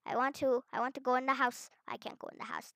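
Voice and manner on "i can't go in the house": whispered aside. Real, not acted. Blocked from house.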